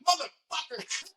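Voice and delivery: hammed-up fury at the other tattooer; mock outrage. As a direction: curse him out in high-pitched disbelief. Retold furious reaction to Robbie.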